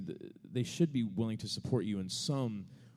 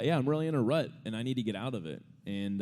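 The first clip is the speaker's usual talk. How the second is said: normal talking voice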